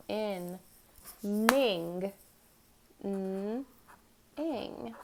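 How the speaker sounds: B, G, In, Ning, N, Ing, each sound apart; staccato syllables. Shown as a demonstration. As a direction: sound out each piece with pauses. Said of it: "Let's do it syllable by syllable".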